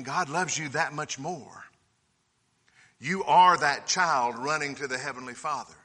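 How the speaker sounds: normal talking voice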